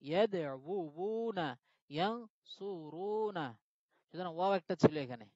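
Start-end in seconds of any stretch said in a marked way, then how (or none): 0.1-3.5 ইয়াদউনা then ইয়ানসুরুনা, both very slow and deliberate; teacher draws out ইয়াদউনা and ইয়ানসুরুনা; slow side-by-side comparison. Shown as a demonstration. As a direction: set ইয়াদউনা against ইয়ানসুরুনা syllable by syllable. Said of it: "মিলছে না তো".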